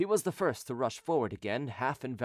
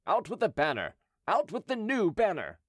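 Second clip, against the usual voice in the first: high-pitched rallying cry, mildly raised; 7 semitones higher than usual. A narrator's reading. Henry to his little army, crying out an order. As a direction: call out the order to the troops. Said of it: "Henry raised his visor and cried".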